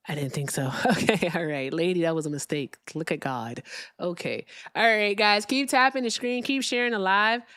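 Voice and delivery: normal talking voice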